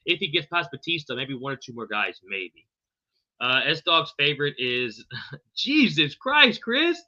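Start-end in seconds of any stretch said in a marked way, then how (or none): 5.6-7.0 drawn-out disbelief. Real, not acted. Another impossible name.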